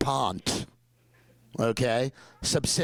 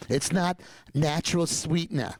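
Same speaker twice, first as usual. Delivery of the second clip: put-on New York accent; 4 semitones higher than usual. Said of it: "Sorry for my New York accent".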